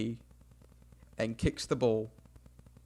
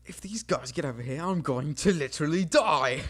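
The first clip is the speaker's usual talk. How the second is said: mock dread; 2 semitones higher than usual. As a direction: play up mock doom as it builds. Dreading the other team's attack.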